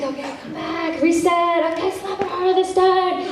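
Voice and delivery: high sing-song director calls; directing mid-scene. Retold or imitated. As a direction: rattle off retake orders in a high, acted voice. Acts out resetting the take.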